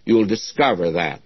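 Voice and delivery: ordinary talk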